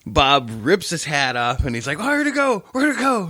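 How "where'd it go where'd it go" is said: high, frantic questioning; acted panic. Storyteller playing Bob after losing sight of the lizard, high panic. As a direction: imitate a coworker in a tizzy.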